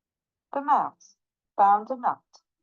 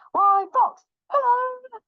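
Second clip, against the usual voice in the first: high, loud, soaring hello; pitched-up sing-out call; gleeful greeting; 5 dB louder than usual. Acted. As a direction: hail them with exaggerated delight. Mouse boldly greets Fox.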